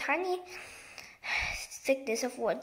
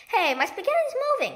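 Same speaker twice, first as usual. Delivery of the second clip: high, loud startled outcry; startled cry; 9 semitones higher, 6 dB louder than usual. A narrator's reading. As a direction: yelp it out, shocked by the moving food. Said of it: "cried Mister Twit".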